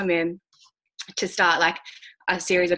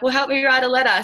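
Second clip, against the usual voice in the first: higher, louder, fed-up retort; exasperated; 7 semitones higher, 5 dB louder than usual. Retold or imitated. Speaker tells colleague to help write the letter, exasperated. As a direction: snap it back, a touch exasperated.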